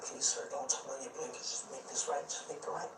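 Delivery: hushed, urgent whisper; whispered plea. Retold or imitated. Begging the driver while hiding in the back seat, whispered. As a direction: plead under your breath so nobody hears.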